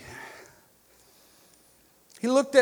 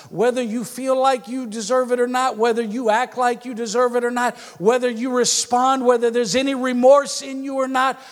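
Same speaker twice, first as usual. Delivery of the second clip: loud, higher, building preacher cadence; preaching at full force; 6 semitones higher, 8 dB louder than usual. Real, not acted. Pastor pressing the congregation, preaching at full force. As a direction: drive each whether harder.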